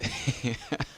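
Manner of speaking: short tipsy giggle. Real, not acted. Giggles at own bad shot.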